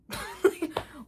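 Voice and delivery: sharp burst of laughter; incredulous laugh. Real, not acted. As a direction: laugh in disbelief. Laugh after retort.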